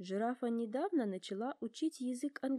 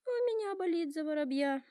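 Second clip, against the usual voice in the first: worried giraffe; 8 semitones higher, 4 dB louder than usual. A narrator's reading. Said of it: "волнуясь, говорит она".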